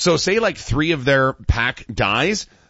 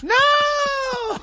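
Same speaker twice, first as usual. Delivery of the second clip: high-pitched panicked shriek. Retold or imitated. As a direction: scream a warning in a panic. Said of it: "your voice could hit those notes".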